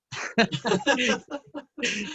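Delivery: high bright laugh bursting out; real burst of laughter. Real, not acted. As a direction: laugh out loud at the joke. Laughs after Laird's joke.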